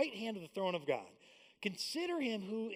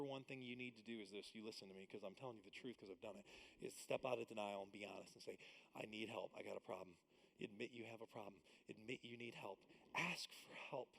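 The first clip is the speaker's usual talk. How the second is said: much lower and softer confession; quiet earnest appeal; 9 semitones lower, 15 dB quieter than usual. Real, not acted. Pastor sharing his rehab story, low and soft. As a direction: drop the voice and speak from the heart.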